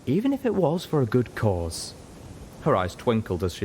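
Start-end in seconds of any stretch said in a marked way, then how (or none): 0.0-1.9 lightly higher, playful; amused Velvet. A narrator's reading. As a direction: say it with a teasing grin. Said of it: "Velvet chuckled with amusement".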